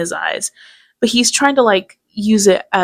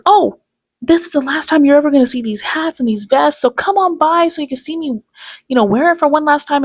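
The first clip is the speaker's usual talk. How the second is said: mocking salesman pitch; 6 semitones higher than usual. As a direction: hype it like a fake farewell sale. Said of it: "he's like".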